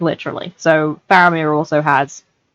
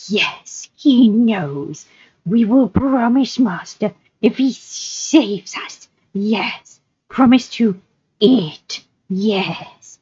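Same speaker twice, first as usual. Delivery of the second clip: slow, high, drawn-out creature voice; Gollum, wheedling; 5 semitones higher, much slower than usual. Acted. Creature swearing to his master, wheedling Gollum voice. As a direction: promise slowly as a fawning creature.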